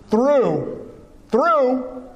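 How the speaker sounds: through repeated slowly, each one leaned on; stress on through. Shown as a demonstration. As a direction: press the word through twice. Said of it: "Don't miss the through".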